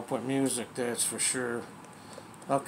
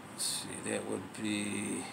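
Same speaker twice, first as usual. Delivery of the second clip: whispered aside to himself, much softer; under-breath whisper; 4 semitones lower, 12 dB quieter than usual. Real, not acted. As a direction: whisper it while fiddling with the dial. Muttering to himself while tuning.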